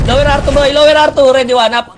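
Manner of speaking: high, loud, urgent game calls; real shouting at teammates mid-fight; frantic shouted callout. Real, not acted. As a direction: yell the calls at your team as the fight peaks.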